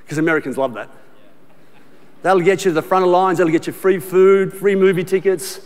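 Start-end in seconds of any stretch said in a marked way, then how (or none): none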